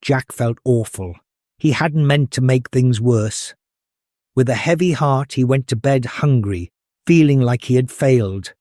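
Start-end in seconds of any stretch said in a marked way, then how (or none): none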